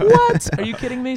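high, loud mock amazement; shocked squeal. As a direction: squeal it like you can't believe it. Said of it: "It's like".

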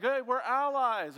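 lightly acted, drawn-out claim of friendship; disguised enemies. Enemies approaching Israel as allies, mock-friendly. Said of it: "whenever they would approach".

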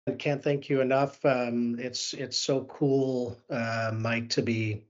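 ordinary talk, nothing marked

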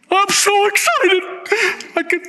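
high, squealing mock excitement; mock excited. Pastor teasing the congregation, hammed-up squeal of excitement. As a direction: ham up the thrill with a squeal. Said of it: "I'm getting too emotional".

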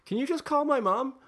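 high whiny mock plea; childish plea. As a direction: ask like a helpless kid. Imitating childish woman.

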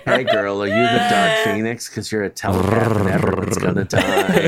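low, loud flirty purr; mock creepy flirting come-on; purring Xavier. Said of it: "purring, like".

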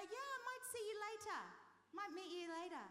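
high, airy mock-polite British brush-off; polite promise. Imitating a British person promising to meet later, falsely polite. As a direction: sing it out sweetly, meaning no. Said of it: "politely say".

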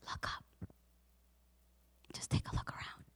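gentle whispered words of Jesus. Acted. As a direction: whisper it tenderly, like lifting her chin. Jesus speaks gently to woman.

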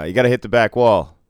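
firm order. Retold or imitated. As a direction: tell him firmly to hit the wall. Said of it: "I said".

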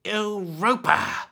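slow, high hissing hag; hissed answer. Acted. Old woman answers the lost girl, slow hiss. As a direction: draw the word out in a hiss. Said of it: "hissed the woman".

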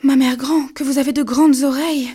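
put-on a child amazed at granny's ears; wondering girl. A narrator's reading. Girl in bed asks granny about her ears, high and loud.

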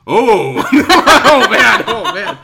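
loud, high, laughing through the words; laughing dismay. Retold or imitated. Joseph reacting to the word cult, loud laughing dismay. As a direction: laugh it out in disbelief. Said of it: "was just like".

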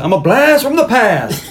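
high, drawn-out movie-villain yell; mock yell. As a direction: holler the one-liner slowly, half laughing. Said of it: "And he yells".

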